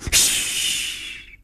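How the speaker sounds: long, soft "shh"; drawn-out whispered hush; hushing shush. A narrator's reading. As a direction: shush someone quietly, finger to lips. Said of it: "把食指立于唇上".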